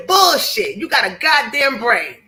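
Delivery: ordinary talk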